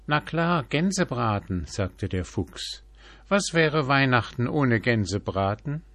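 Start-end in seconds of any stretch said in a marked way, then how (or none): 0.1-1.6 high, eager fox voice; a greedy fox licking his lips; excited fox. A narrator's reading. 3.3-5.8 higher, louder fox asking; a greedy fox insisting; rising fox question. A narrator's reading. Fox voiced in fable.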